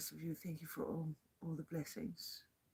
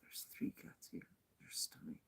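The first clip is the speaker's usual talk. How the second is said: hushed whisper so the cats stay undisturbed; 10 semitones lower, 9 dB quieter than usual. Real, not acted. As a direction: whisper it softly, just between us. Whispering about the cats nearby.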